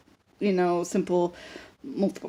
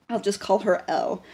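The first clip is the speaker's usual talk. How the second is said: normal talking voice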